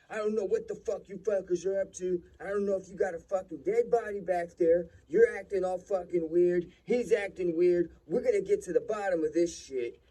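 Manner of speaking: loud, high-pitched ranting cop imitation; angry accusing cop. Cop suspects a body in the truck, shouting angrily. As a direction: act out an officer's shouted accusations.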